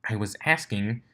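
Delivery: ordinary delivery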